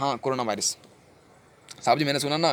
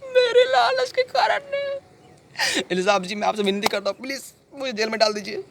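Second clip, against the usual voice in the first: tearful remorse; 11 semitones higher than usual. Acted. Guilty man begging sahab for jail, crying. Weeping, begs to be jailed.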